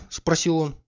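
ordinary delivery

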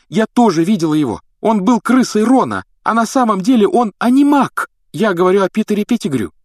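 put-on high, loud girl's plea; urgent insistence. A narrator's reading. Hermione backing Harry before the minister, urgent.

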